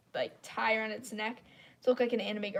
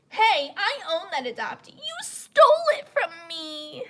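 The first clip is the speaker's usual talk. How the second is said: higher, louder mock whine; whiny accuser; 7 semitones higher, 8 dB louder than usual. Mocking the user who claimed the adoptee, whiny. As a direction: mimic her petty accusation. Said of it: "She goes".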